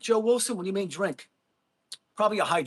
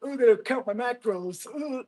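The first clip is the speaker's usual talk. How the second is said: mocking meathead; 4 semitones higher than usual. Streamer mocks a bodybuilder, hammed-up gym-bro voice. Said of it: "grown men".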